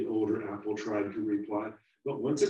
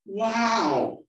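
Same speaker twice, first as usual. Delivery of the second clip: high, loud, amazed cry; amazed wow; 14 semitones higher, 11 dB louder than usual. Acted. Little apple amazed at its future, high and loud. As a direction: burst out in wonder. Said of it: "All the little apple could say".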